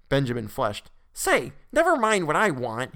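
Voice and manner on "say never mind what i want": put-on high, slow, indignant boy's retort; angry retort. A narrator's reading. Boy answers the mocking clerk, high and indignant. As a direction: snap back like an offended kid. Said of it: "he retorted angrily".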